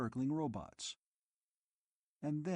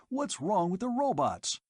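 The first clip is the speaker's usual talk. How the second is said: high, louder frightened question; asked shrilly; 7 semitones higher, 4 dB louder than usual. A narrator's reading. Dodeth asking the keepers in fright, shrill and high. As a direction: panicky pitch up. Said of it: "he asked shrilly".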